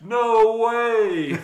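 high, loud drawn-out refusal; yelling back. Retold or imitated. Carter answering the big guy down the fairway, yelling back. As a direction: holler it back across the fairway. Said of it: "Carter yells back".

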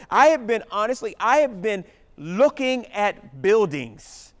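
loud, fervent preaching. Real, not acted. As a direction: preach it with rising fire. Full-force preaching.

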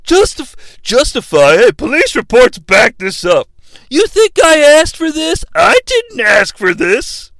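put-on defensive wrestler voice; hammed-up self-justification; Triple H. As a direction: protest as a mock-indignant wrestler, pitch swinging up. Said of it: "Hunter doesn't really do his game voice".

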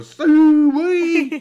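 high, loud, drawn-out hog holler; hog call. Acted. Pig jury signals guilty, hollered high and drawn out. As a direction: call the pigs in, high and long.

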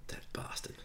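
very soft, whispered mock indignation; mock-outraged whisper. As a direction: curse him under your breath, jokingly. Mock outrage at comp stomp story.

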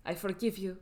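lightly imitated Russian accent. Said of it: "Are you gonna do the Russian accent?".

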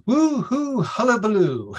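high, loud gleeful whoop; gleeful taunt. Retold or imitated. Darby mocking the captured leprechaun king, high gleeful whoop. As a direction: crow it out high like a giddy trickster. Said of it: "one of his responses is".

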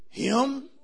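"him" said high and loud; punched him. Shown as a demonstration. Preacher contrasting him with us, stress on him. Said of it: "He got".

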